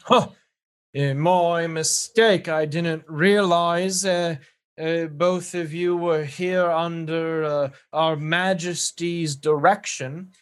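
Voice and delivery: slow, hesitant, apologetic British guard. Acted. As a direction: back down haltingly as a British official. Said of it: "this guard turns to you and says".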